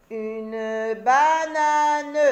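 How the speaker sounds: banane étiré, plus fort; découpe banane en syllabes, très lentement; très lent, syllabé. Shown as a demonstration. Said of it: "syllabé puis normal". (dire « une banane » syllabe par syllabe)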